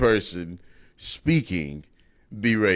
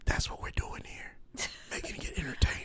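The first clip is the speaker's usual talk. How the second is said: hushed, voiceless whisper; whispered aside; 15 dB quieter than usual. Real, not acted. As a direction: whisper it under your breath. Muttered aside to co-host.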